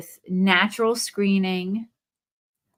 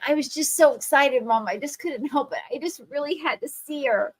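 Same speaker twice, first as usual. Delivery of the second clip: put-on sheepish excited kid; 6 semitones higher than usual. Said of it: "Gabe was like".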